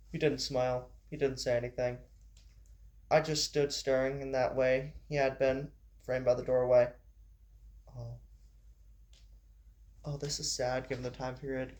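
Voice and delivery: plain reading voice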